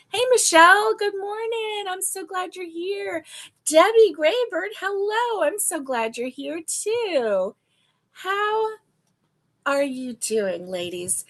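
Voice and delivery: excited greeting, pitch lifted high; cheery greeting. Real, not acted. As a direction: welcome each viewer warmly by name. Greeting viewers in the live chat.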